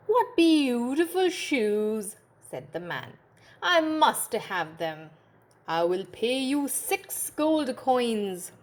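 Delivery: slow, pleased customer voice; rich man admiring. Acted. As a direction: savour the beautiful shoes. Rich man buying shoes.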